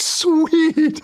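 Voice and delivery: drawn-out, very high-pitched mock cheer; delighted squeal. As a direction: squeal it in exaggerated delight, stretching the word. Retold delight at getting the house.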